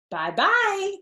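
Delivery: high, loud, sing-song farewell; called out. A narrator's reading. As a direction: call it out cheerfully from above. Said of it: "the Lang kids called out".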